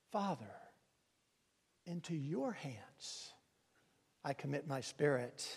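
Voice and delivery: calm, trusting last words; tender trust. Retold or imitated. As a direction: hand it over gently and quietly. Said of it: "notice the change".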